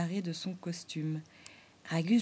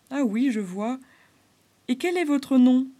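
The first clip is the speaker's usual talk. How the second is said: a higher, louder beaver voice; beaver asking; 5 semitones higher, 8 dB louder than usual. Acted. Beaver asking the clown's name, louder. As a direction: ask kindly like the old beaver.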